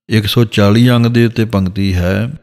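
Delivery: ordinary talk, nothing marked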